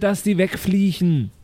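ordinary delivery

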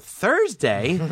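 Thursday? asked with a rising tune; disbelieving echo. Retold or imitated. Young comic repeating the agent's answer, Thursday? rising in disbelief.

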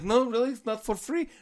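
high, loud mock disbelief; shocked neighbor. Armenian neighbor learning care isn't free, mock shock. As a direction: play the neighbor's stunned surprise, hammed-up. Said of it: "He's like".

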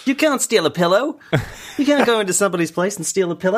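put-on Murray from [name], Kiwi accent; Murray impression. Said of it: "I can only do Murray from [name]".

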